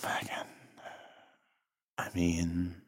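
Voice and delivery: heartbroken, hushed and low; barely-there breath of a voice, acting heartbroken; sad and slow. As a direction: whisper it low as if crushed. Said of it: "decided to go sad and slow".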